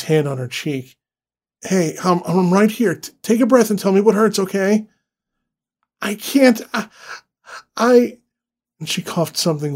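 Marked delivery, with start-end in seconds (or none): 1.6-4.9 urgent. Acted. Husband tries calming trapped wife. 6.0-8.2 panicked. Acted. Injured wife struggles to speak.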